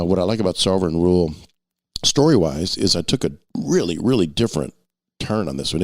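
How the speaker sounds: normal talking voice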